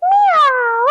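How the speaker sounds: high loud meow; kids' yoga cat pose meow, high and loud. Pretend. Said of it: "and say".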